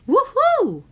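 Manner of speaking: very high loud whoop; joyful whoop. A narrator's reading. Jonah whoops that the hiccups are gone, very high. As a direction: whoop with relief. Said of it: "Jonah exclaimed".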